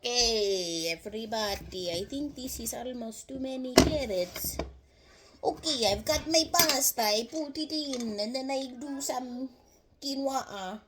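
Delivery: put-on Italian-cook accent. Showing everybody the pasta and carrots, in an Italian accent. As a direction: talk through the recipe in playful Italian English. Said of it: "Forgive my Italian accent".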